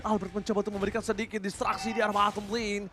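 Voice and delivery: usual speaking voice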